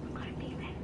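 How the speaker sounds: whispered to the viewers. Real, not acted. Streamer whispers to viewers so his mom won't hear. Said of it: "Better be quiet".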